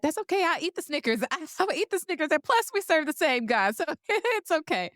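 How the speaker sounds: husband's teasing reply, pitched high; husband voiced high. Wife quoting her husband eating the Snickers, playful high voice. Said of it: "he was like".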